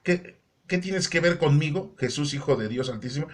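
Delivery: ordinary delivery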